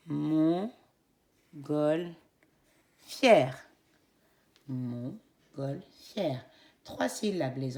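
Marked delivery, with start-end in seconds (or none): 0.1-6.4 Montgolfière very slowly cut into three beats; syllable by syllable. Shown as a demonstration. Teacher correcting with the class, slowly. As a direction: stretch out Montgolfière beat by beat. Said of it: "Nous corrigeons".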